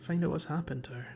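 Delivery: normal talking voice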